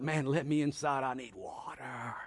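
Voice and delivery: fading, soft mock plea; a hint of an exhausted dog begging; lightly voiced thirsty dog. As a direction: trail off weakly as a parched dog. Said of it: "he's looking at me going".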